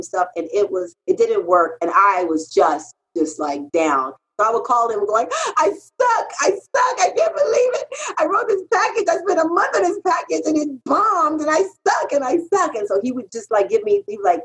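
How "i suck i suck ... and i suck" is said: high, whiny self-pitying wail with a laugh; whining crybaby. Retold or imitated. Whining to her mentor on the phone, high self-pitying wail. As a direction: moan like a crybaby who thinks she's hopeless. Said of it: "my little whining crybaby moments".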